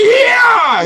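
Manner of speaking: high, loud whooping yell; full-throated, high-pitched "yeah"; Dean-style rally scream. Retold or imitated. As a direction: let out a wild campaign-rally scream. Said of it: "when he won every state, I was right there with him".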